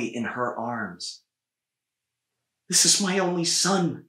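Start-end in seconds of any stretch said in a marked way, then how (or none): 2.7-4.1 higher, louder plea of a frantic mother; crying mother. A narrator's reading. Mother begging Blaze for her son, frantic cry. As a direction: plead for your child. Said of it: "she cried".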